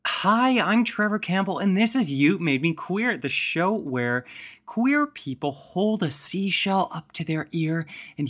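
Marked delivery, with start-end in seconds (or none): 0.0-6.9 big show opening; podcast host intro. Acted.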